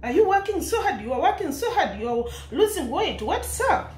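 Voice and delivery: high, playful teasing son voice; imitating the son. Son teasing his mom about losing weight, playful high voice. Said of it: "Like, touching on her".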